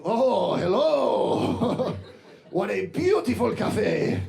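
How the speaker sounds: put-on high, effusive dad with a thick Latin accent; heavy Latin American accent. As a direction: greet grandly in a stretched-out Spanish accent. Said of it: "very heavy Latin American accent".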